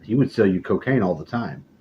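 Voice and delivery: normal talking voice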